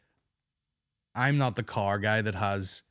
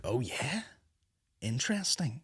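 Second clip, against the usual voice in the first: softly intrigued, voice lifting on the question; quiet, acted-out curiosity; 2 semitones higher, 7 dB quieter than usual. Acting out interest in odd watches.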